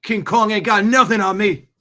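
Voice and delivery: loud, high movie-star bravado; hammed-up defiant film outburst; Denzel impression. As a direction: roar it out as a cocky boast. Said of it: "I was just watching a Denzel clip".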